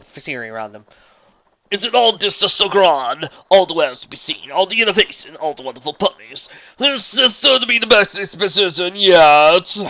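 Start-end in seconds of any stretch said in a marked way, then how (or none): 1.7-9.8 loud, slow, sweeping proclamation; grandiose showman. A narrator's reading. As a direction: marvel grandly, stretching the big words. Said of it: "came a grandiose voice".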